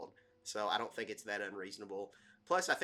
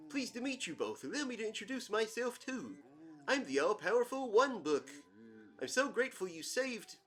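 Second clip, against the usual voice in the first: higher-pitched, swooping cartoon character voice; Bullwinkle; 12 semitones higher, 3 dB louder than usual. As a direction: play a goofy talking book, pitched up and louder. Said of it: "a Bullwinkle voice".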